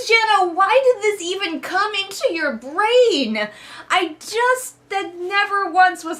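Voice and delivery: high, loud, slowed outburst of disbelief; exasperated disbelief. Real, not acted. Reviewer asking the author Jenna, exasperated and high. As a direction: pitch way up, baffled and fed up.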